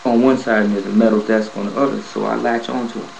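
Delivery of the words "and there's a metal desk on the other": ordinary delivery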